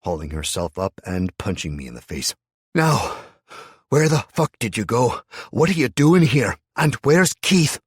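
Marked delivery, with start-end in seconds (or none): 2.7-7.8 high, loud screaming demands; furious interrogation. A narrator's reading. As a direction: yell each question at him. Hannah punches and interrogates.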